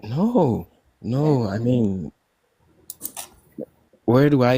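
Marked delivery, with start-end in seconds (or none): none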